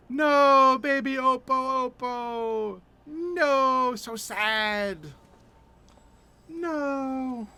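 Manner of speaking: high, drawn-out wail of sympathy; playful lament. Real, not acted. Laments the baby monkey.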